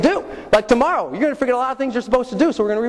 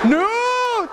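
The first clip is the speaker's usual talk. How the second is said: high, drawn-out wail; panicked refusal; 13 semitones higher than usual. Retold or imitated. Jumper refusing as instructor counts down, panicked wail. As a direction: stretch it into a pleading cry. Acted panic before being thrown out.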